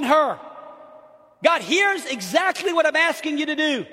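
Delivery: loud, high preacher's plea; desperate pleading. Acted. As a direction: cry out urgently, phrase by phrase. Acts out father's desperate plea.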